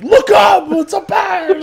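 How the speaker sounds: very high, loud holler; hammed-up overexcited bystander yell; excited shout. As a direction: scream it like a frantic onlooker. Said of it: "why the fuck was he so excited".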